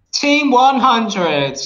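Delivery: slow, high robotic read; text to speech voice. Pretend. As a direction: read it like a TTS bot. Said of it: "I'll read it in a text to speech voice".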